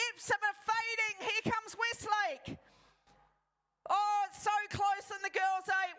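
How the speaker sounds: urgent commentator building to the line; excited race call. Real, not acted. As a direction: call the final sprint with rising excitement. Race finish called live, rising pitch.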